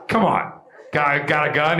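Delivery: usual speaking voice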